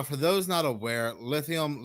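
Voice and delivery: ordinary talk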